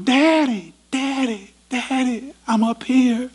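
put-on high, pleading child's call from the roof; boy calling for daddy. Trapped boy calls down to his father, high and pleading. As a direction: cry up to Daddy like a frightened little boy.

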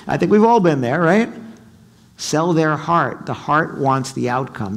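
ordinary talk

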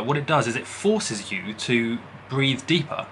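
usual speaking voice

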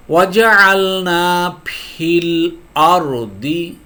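slow, drawn-out recitation of ওয়াজআলনা ফিল আরদি; Quranic recitation. Shown as a demonstration. Teacher reciting the verse to students, slow melodic recitation. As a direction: chant ওয়াজআলনা ফিল আরদি slowly, stretching each vowel. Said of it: "একটা আয়াত দেখুন এখানে".

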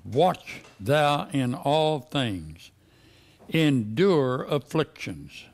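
very slow, with "Watch" leaned on; very slow emphasis. Acted. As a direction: drag each word out. Preacher repeats verse, drawn out.

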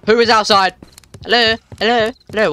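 high, urgent questioning; alarmed calling out. Real, not acted. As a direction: call out sharply to an unseen intruder. Challenging someone breaking the window.

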